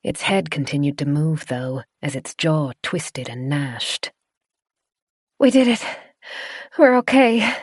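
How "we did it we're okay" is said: relieved cry. Acted. Relief after escape.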